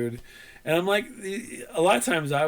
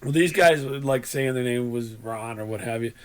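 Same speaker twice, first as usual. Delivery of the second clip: usual speaking voice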